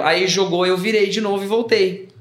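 ordinary delivery

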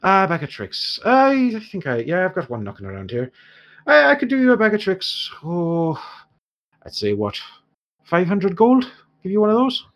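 put-on haggling dwarf merchant in an Irish accent; dwarf shopkeeper in an Irish accent haggling with the heroes. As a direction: bargain in a lilting Irish dwarf voice.

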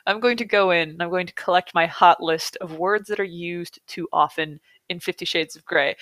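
usual speaking voice

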